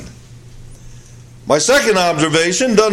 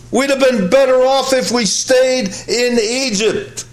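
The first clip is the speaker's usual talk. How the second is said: higher, whining grumble of doubters; whining complaint; 6 semitones higher than usual. Retold or imitated. People regretting the exodus, whining higher. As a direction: grumble like frightened people who regret leaving. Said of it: "reasoning in their hearts, in their minds".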